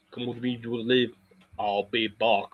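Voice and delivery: understated Austrian action-hero impression; Arnold Schwarzenegger. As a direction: toss off the catchphrases in a mock Austrian accent. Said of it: "trying to sound like Arnold Schwarzenegger".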